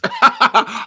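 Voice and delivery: high, loud burst of laughter. Real, not acted. Cracking up at their own question, loud high laughter.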